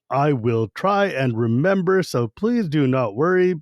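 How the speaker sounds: slow, every word spelled out; robotic, careful word by word; over-enunciated. Retold or imitated. Gnome slows down for Taz, robotic enunciation. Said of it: "every single word suddenly becomes specifically enunciated".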